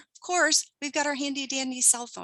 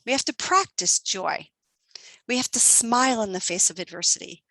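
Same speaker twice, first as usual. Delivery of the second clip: ordinary talk, nothing marked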